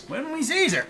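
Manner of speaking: high goofy voice; silly high voice. Pretend. High dialect repeat.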